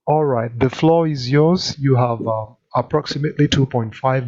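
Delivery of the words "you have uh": ordinary delivery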